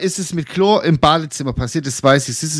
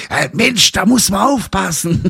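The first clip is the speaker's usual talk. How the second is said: higher scolding voice of the neighbour, light imitation; mock the neighbour telling her off, pitched up; 6 semitones higher than usual. Retelling how neighbour Liesel scolded her, higher mock scold. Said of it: "Und hat die mich noch geschimpft".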